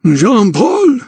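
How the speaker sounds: high-pitched French barber's summons; high, drawn-up call of a name. A narrator's reading. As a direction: call your son from the back room, pitched high. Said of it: "he called".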